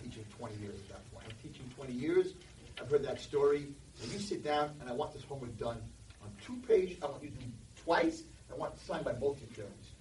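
normal talking voice

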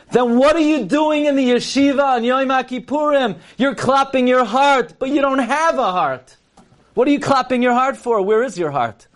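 high, pleading preacher's cry; a rabbi rising to a heartfelt challenge; impassioned rebuke. Retold or imitated. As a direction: plead with them, voice lifted high and straining. Rabbi's fiery rebuke at Kol Nidre.

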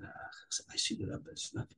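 whispered, meek and hesitant; sheepish whisper. Retold or imitated. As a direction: whisper it shyly, not wanting to be heard. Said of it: "So sheepish".

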